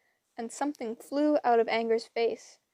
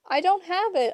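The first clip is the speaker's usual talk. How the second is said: higher, rushed protest; panicked; 7 semitones higher, 4 dB louder than usual. A narrator's reading. Percy protesting to Annabeth in panic. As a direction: blurt it out in a panic.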